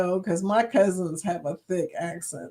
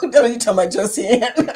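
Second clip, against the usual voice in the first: laughing; 2 semitones higher, 4 dB louder than usual. Real, not acted. Reacting to a viewer comment.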